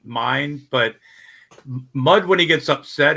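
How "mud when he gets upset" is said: ordinary talk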